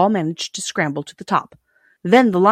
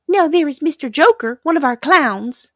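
high little princess voice, louder; dainty china princess; 10 semitones higher, 5 dB louder than usual. A narrator's reading. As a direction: explain primly as a fragile china lady.

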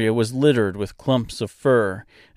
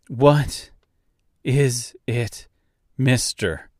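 frustrated; 3 semitones higher, much slower than usual. Acted. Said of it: "She was clearly frustrated".

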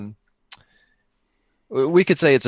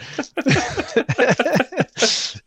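laugh; 12 semitones higher than usual. Real, not acted. Laughs at own guess.

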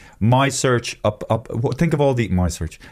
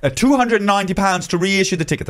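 much higher, put-on agent voice; mock scam agent; 10 semitones higher than usual. Mocking the scammer quoting a reissue fee, high put-on voice. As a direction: play the smug fake agent quoting a fee. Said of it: "I'm a bit of a fucking agent".

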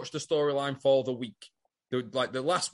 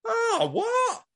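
oh what squeaked far higher, drawn out; mock shocked what. Toadie reacting to Amy, mock startled and much higher. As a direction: ham up a startled double take.

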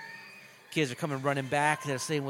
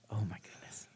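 stunned whispered aside; whispering; 3 semitones lower, 10 dB quieter than usual. Real, not acted. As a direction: whisper it in disbelief. Reacting to violent scene.

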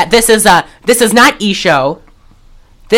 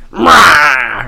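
lower, drawn-out mock demon cry; demon roar; 5 semitones lower than usual. Host showing the possessed woman going full demon, demon roar. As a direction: let out a mock demonic yell. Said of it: "goes full demon".

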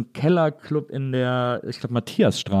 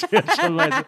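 laughing. Real, not acted. Laughs at own punchline.

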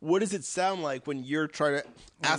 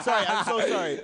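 normal talking voice

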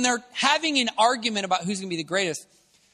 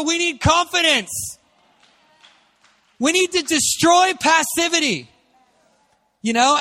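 higher, louder preaching at full force; fiery preaching; 8 semitones higher, 4 dB louder than usual. Acted. Preacher urging young Brits to confidence, fiery preaching. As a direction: preach it hard to the room.

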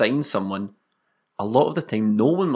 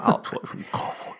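put-on whispered staunch Hun accent, lip in; breathy staunch Hun accent, bottom lip sucked in. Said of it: "you suck your bottom lip in over your teeth".

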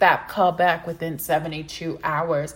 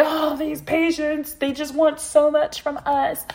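higher, whiny mock gripe about patients; mock complaining; 8 semitones higher than usual. Voicing fellow therapists griping about demanding patients, mock whiny. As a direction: play the grumbling therapist, lightly. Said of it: "as much as we want to complain and say".

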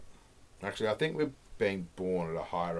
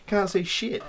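ordinary talk

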